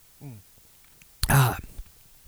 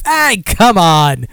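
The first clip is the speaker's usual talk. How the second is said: mock protest; 5 semitones higher, 4 dB louder, much slower than usual. Mock protest at viewer's remark.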